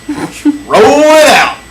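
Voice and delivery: loud, high, drawn-out holler; big comic entrance call; mock announcement. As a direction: stretch it way out, swooping up loud.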